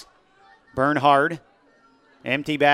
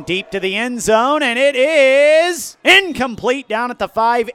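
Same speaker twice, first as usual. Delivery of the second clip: excited call; 8 semitones higher, 7 dB louder than usual. Real, not acted. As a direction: shout the incompletion as it lands. Deep pass to end zone.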